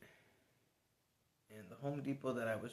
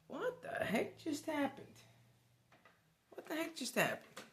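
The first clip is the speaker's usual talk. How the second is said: stunned disbelief. Retold or imitated. Left alone in the parking lot, high-pitched baffled disbelief. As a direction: ask it like you can't believe your eyes.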